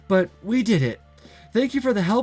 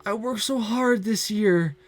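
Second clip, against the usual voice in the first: tearful; 6 semitones higher, slower than usual. Acted. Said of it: "I hear loud sobs escape her".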